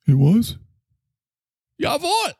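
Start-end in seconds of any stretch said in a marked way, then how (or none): 1.8-2.3 high German outburst; German accent. Acted. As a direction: blurt it in an involuntary German accent. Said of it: "he did it again".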